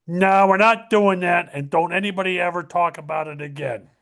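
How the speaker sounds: normal talking voice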